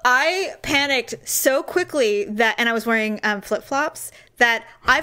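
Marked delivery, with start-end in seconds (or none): none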